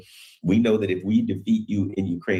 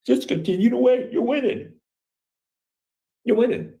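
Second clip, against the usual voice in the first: high, sly whispered-close voice pitched well up; mock advice to Russia. Talking to Russia as a patient adviser, high and coaxing. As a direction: coax them gently from far up high.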